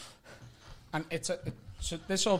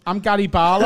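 loud, raised imitation of a tribute singer; mock proud announcement; 4 semitones higher, 9 dB louder than usual. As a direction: announce yourself like the star himself.

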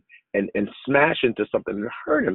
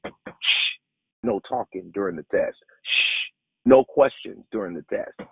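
God hushing like a teacher; 7 semitones lower than usual. Pretend. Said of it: "God is saying to some of you".

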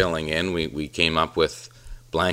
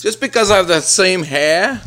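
high, loud protesting son; Joe Vanelli; 14 semitones higher, 7 dB louder than usual. Acted. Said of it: "And he says".

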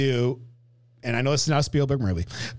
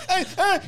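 put-on playful kid noise; 14 semitones higher than usual. Mimicking Mikey dropping the frame.

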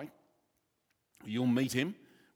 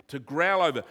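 usual speaking voice

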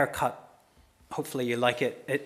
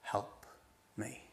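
hushed, halting prayer with long pauses; small, helpless appeal to a father; quiet plea; 3 semitones lower, 9 dB quieter, slower than usual. Acted. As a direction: plead softly, word by word.

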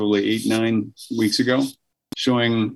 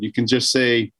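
ordinary talk

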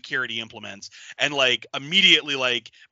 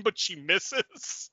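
ordinary talk, nothing marked

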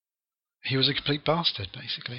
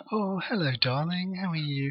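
softer, sweet-talking husband on the phone, starting high and sliding down; put-on phone voice; 5 semitones higher, 4 dB quieter than usual. Husband takes his wife's call about a pony, put-on phone voice. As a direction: imitate an actor's polite, disinterested phone manner. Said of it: "he was doing his, his phone voice".

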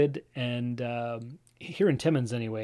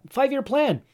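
bursting with store excitement, pitched up and loud; eager, raised voice; excited; 10 semitones higher, 7 dB louder than usual. Real, not acted. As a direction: announce it like big news. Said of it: "I'm very excited to start up this store".

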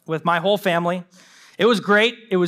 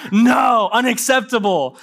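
louder, firm refusal; indignant snap; 2 semitones higher, 4 dB louder, slower than usual. Retold or imitated. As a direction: put your foot down, a bit louder. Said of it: "You know, like".

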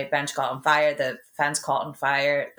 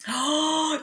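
high, sharp intake of breath; real gasp of recollection; sudden gasp; 10 semitones higher than usual. Real, not acted. Suddenly remembers last night.

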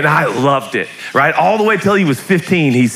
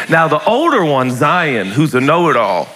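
ordinary delivery

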